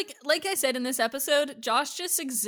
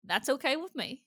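put-on Texas accent; 7 semitones higher than usual. Telling listeners they may hate it, Texas accent. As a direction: defy them with a Southern twang. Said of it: "hate on my accent".

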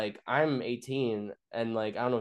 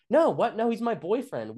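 high-pitched mock girlfriend voice; imitated protesting wife; 10 semitones higher, 4 dB louder than usual. Wife defending her boyfriend to a cop, protesting.

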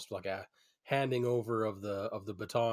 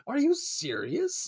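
much higher, slow disbelieving question; surprised; 4 dB louder, slower than usual. Retold or imitated. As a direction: stretch it out in disbelief. Retold reaction to huge order.

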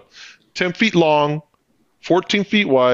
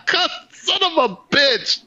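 laughing high curse; 9 semitones higher than usual. Retold or imitated. Retells reaction laughing.

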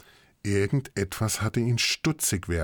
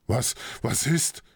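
alarmed; 5 semitones higher, slower than usual. Acted. Torvald alarmed by scream.

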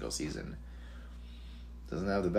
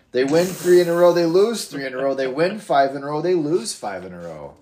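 loud emphatic list; 6 semitones higher, 9 dB louder than usual. Real, not acted. Building loud rant.

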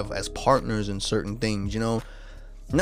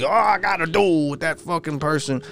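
mocking hyped follower; 5 semitones higher than usual.

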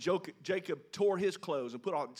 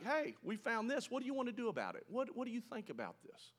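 light, higher, casual questions; offhand suggestion; 7 semitones higher, 5 dB quieter than usual. Shown as a demonstration. Preacher voicing the brothers' casual suggestion to their father. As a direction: shrug it off as if just asking. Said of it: "It's like".

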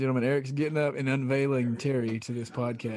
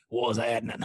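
a lower voice imitating an old smoker; 4 semitones lower than usual. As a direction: repeat it like the bike guy. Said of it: "That fucking smoker voice".

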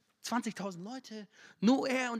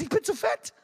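high, loud mock voice of a girl at the mirror; mock self-talk; 10 semitones higher, 6 dB louder than usual. Preacher mimics teen girl at mirror, high mock voice. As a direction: put on the insecure girl's whine.

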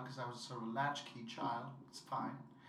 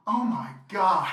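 laughing exclamation; 4 semitones higher, 13 dB louder, slower than usual. Retold or imitated. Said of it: "she definitely says".

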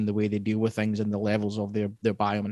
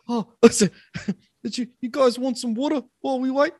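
high polite host voice; 13 semitones higher than usual. Retold or imitated. Voicing a polite cannibal.

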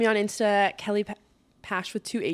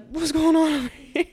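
nosy onlooker; 7 semitones higher, 4 dB louder than usual. Pretend. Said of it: "I'm gonna be sitting in here like".

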